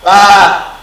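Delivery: a long, drawn-out loud "Ah"; long A vowel. Shown as a demonstration. Teacher to pupils, demonstrating the long A loudly. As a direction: hold the "Ah" long for the class. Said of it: "c'est le A qui est comment? Long".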